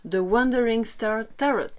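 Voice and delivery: English title read in a French accent. Real, not acted. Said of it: "excusez-moi pour l'accent".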